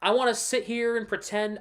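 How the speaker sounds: usual speaking voice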